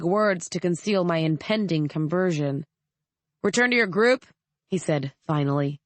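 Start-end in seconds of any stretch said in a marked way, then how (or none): 3.4-4.3 higher, firm command; soldier's curt order. A narrator's reading. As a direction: bark the order at the journalist.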